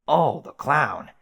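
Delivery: slow, loud and scornful; contemptuous. Acted. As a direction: drag it out with disgust. Caliborn recalls the clown.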